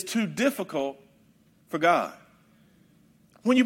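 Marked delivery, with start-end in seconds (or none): none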